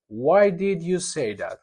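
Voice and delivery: slow, careful "Why did you say that?"; slow model question. Shown as a demonstration. Teacher modeling a why question for the class, slowly. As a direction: read it slowly for students. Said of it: "teacher models question".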